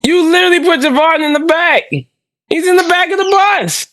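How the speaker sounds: high, heated rant; angry. Real, not acted. Ranting about the poster.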